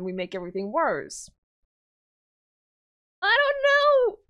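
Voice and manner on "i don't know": high, loud panicked wail. Real, not acted. Player torn over killing the Vodnik, high panicked wail. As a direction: blurt it out in panic.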